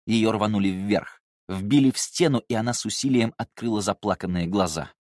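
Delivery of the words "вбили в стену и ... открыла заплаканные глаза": plain reading voice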